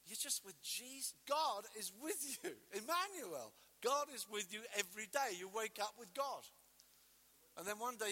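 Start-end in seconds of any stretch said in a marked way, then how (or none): none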